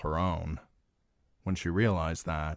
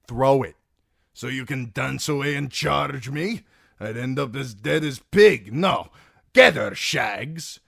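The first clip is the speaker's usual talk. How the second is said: a loud, raised fighter's voice, wary; Dornishman's gruff battle voice; 8 semitones higher, 8 dB louder than usual. Acted. Timion egging on the fool, loud and tense. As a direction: bark it like a wary sellsword.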